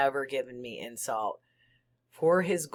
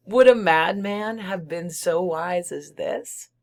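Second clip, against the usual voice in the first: loud high cackling taunt; manic laughter; 4 semitones higher, 7 dB louder than usual. Acted. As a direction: cackle it like a gleeful madman. Madman cackles, taunts listener.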